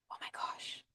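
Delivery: hushed "oh my gosh"; breath-only dramatic aside; awed whisper. Shown as a demonstration. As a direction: whisper it to pull the audience in. Demonstrates emotional story to captivate audience.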